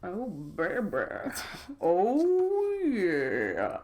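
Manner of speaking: hammed-up ogling at a striptease; mock lustful. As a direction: imitate being turned on, stretching it out. Imitates being turned on by show.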